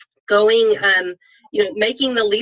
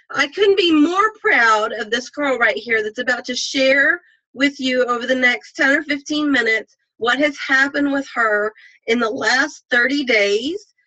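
ordinary talk

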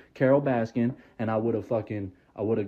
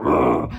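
very low, rumbling growl; short, deep canine growl; imitated dog growl; 14 semitones lower, 4 dB louder than usual. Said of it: "the dog started growling".

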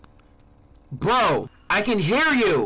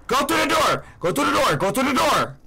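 urgent yelling; 8 semitones higher than usual. Real, not acted. Urging the character to escape.

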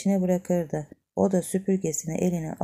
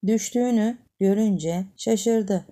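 ordinary delivery

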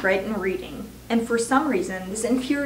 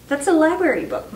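higher, louder alarmed protest; crying out; 6 semitones higher, 4 dB louder than usual. A narrator's reading. Matilda to her father tearing the book, crying out higher and louder. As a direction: cry out to stop him. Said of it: "Matilda cried".

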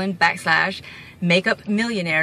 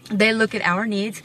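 ordinary talk

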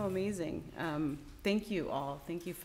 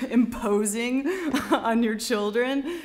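real laugh breaking into the words; 7 semitones higher, 8 dB louder than usual. Real, not acted. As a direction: talk through a giggle, pitched higher. Amused at her own parenting confession.

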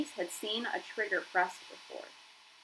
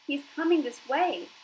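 urgent warning; 7 semitones higher, 5 dB louder than usual. Acted. Gosling warns of approaching killer robot.

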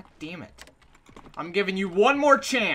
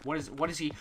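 usual speaking voice

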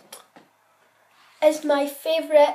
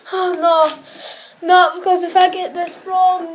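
ordinary talk, nothing marked

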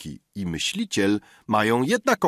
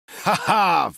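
loud, higher ha-ha burst of laughter; exclaimed laugh; 8 semitones higher, 3 dB louder than usual. A narrator's reading. Vaudevillist cries out to the diners with a laugh. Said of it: "wykrzyknął wodewilista Kursy".

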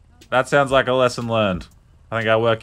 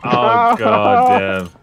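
shocked exclaim; 9 semitones higher, 6 dB louder, much slower than usual. Real, not acted. Reacts to sudden trouble.

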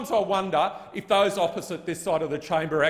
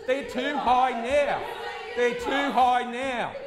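shouting; 5 semitones higher, slower than usual. Real, not acted. Shouting over chamber interjections.

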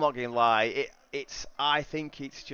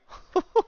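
burst of laughter; 8 dB louder than usual. Real, not acted. Watching a racing clash.

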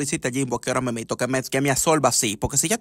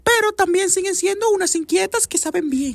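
put-on a high squeaky girlfriend voice; high girly voice. Girlfriend refusing zombie films in a high squeaky voice. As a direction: pipe it up high like a fussy young woman.

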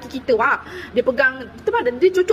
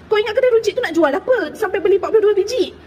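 higher, louder imitated scolding; mock angry ustaz; 7 semitones higher, 4 dB louder than usual. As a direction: imitate the ustaz berating her over limes. Ustaz marah pasal limau, diajuk. (ajuk ustaz memarahi dengan suara tinggi dan kuat)

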